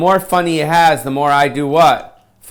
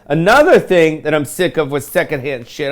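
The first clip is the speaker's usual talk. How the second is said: ordinary delivery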